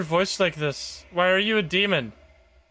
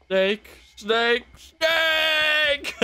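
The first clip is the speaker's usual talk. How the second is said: mock Metal Gear call; 10 semitones higher, much slower than usual. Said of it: "I'm Solid Snake".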